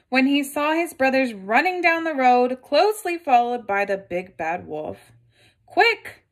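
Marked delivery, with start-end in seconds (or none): 5.7-6.2 high, louder urgent call; urgent cry. Acted. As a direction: warn the brothers to run. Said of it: "cried the third little pig".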